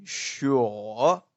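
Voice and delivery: long loud "sure" sagging then rising; British questioning tune. Shown as a demonstration. Teacher shows the British guy's word, drawn-out questioning rise. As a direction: stretch "sure" down and back up. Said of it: "this British guy saying".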